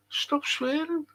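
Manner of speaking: high, hushed mimicked reprimand; mocking scold. Streamer mimicking a complaint about his language, mock scolding.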